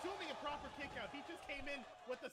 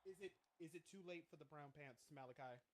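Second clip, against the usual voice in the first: quiet dejected plea; 9 semitones lower, 12 dB quieter than usual. Real, not acted. Despairing plea to cohost.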